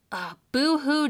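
loud mock boo-hoo; sarcastic fake sobbing; fake crying. Mocking the killer's crying.

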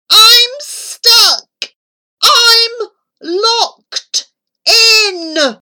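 high loud desperate hiss, stretched. A narrator's reading. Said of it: "Charlie hissed a little louder".

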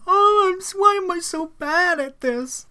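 over-the-top blubbering self-pity; mock weeping. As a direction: wail it like a spoiled daughter crying. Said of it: "Emily starts weeping again".

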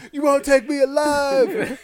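high, slow, loud yell; hammed-up high-pitched defiance; defiant last stand. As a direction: shout it like a cornered outlaw. Imagined kid's last stand.

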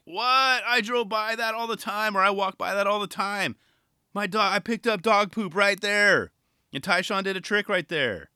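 acted amazement. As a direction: act floored that it happened right there. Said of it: "We're like".

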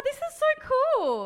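high, loud thrilled gush; excited squeal. Real, not acted. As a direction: squeal with delight. Unpacking gifts.

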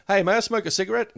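high, polite request; polite high ask. Retold or imitated. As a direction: ask sweetly for permission. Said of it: "you ask the bus driver".

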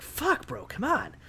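hushed, high-pitched complaint as the donkey; fed-up donkey, small high voice; exasperated donkey. Retold or imitated. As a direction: quietly squeak out the donkey's protest. Donkey's reaction to being hit.

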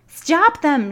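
high, loud shriek. Acted. Umbridge ordering the squad to stop the twins, shrieking. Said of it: "shrieked Umbridge".

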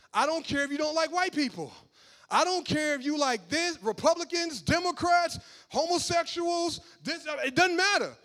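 high, loud preacher's cry building to a shout; preaching at full force. Real, not acted. Preacher to the congregation, full-force loud preaching. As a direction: drive it home from the pulpit, all means all.

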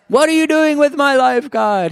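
loud, high-pitched hammed-up rant; exasperated cry to God. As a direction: wail it at heaven in mock desperation. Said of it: "have you ever asked God".

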